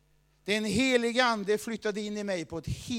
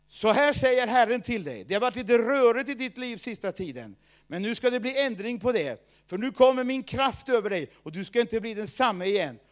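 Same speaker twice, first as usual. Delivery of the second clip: louder, proclaiming prophecy voice; prophetic word; 2 semitones higher, 7 dB louder than usual. Retold or imitated. Leader prophesying over Kjell on the floor, loud proclamation. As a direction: announce it like a prophet declaring God's word. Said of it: "så säger han så här".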